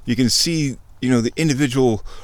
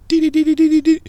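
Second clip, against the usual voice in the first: very high, louder sung-out beeps; computer beeping; 6 dB louder, faster than usual. Retold or imitated. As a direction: imitate a computer's tracking beeps. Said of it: "the computer goes".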